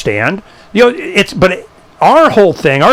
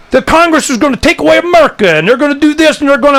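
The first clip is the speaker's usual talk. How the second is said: mocking alarmist; 9 semitones higher, faster than usual. Mocking alarmist talk about Congress.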